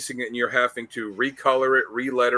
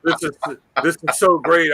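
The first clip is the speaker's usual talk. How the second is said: louder mock gushing, savoring it; mock ecstatic Liefeld; 3 semitones higher, 4 dB louder than usual. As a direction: finish the hammed-up rapture. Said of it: "and be like".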